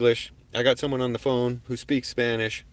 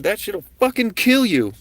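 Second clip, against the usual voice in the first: raised warning; 9 semitones higher, 6 dB louder than usual. Retold or imitated. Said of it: "And I said".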